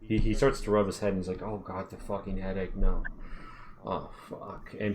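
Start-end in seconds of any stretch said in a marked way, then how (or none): 1.4-4.8 pained groan. Acted. Greg in headache pain.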